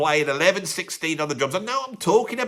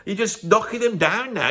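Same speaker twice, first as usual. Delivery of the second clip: ordinary delivery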